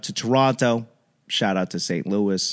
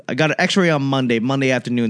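usual speaking voice

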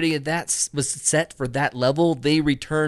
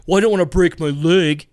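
whiny wrong way; 3 semitones higher, 5 dB louder than usual. Shown as a demonstration. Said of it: "Don't approach and say".

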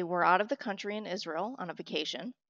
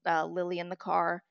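normal talking voice

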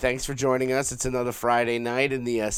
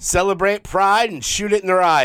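big loud radio announcer voice, higher; booming announcer; 9 semitones higher, 10 dB louder than usual. Pretend. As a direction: boom it out like a radio host. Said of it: "Why do I sound like a radio voice person?".

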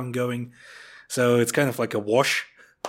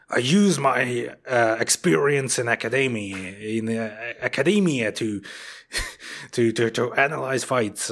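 mock American accent. Parodying an analyst who watched the fight forty-seven times, mock American accent. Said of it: "doing an American accent for this one".

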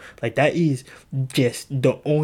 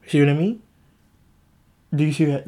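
usual speaking voice

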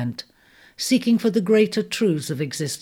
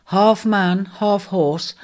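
plain reading voice

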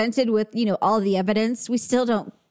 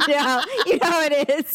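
laughing; 5 semitones higher than usual. Real, not acted. Laughing aside.

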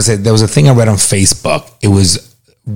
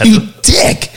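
mock insult; 13 semitones higher than usual. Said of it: "I'm like".

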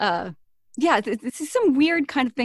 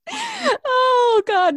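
laughing; 13 semitones higher, 8 dB louder than usual. Real, not acted. Laughing at own mistake.

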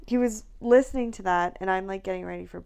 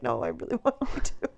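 laughing; 4 semitones lower than usual. Retold or imitated. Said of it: "but then I'm like".

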